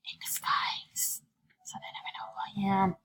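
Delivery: hushed, secretive whisper; conspiratorial whisper. Pretend. Player whispering her secret disguise plan. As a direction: whisper it like a sneaky spy.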